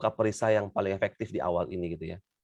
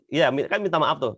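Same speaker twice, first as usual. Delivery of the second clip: usual speaking voice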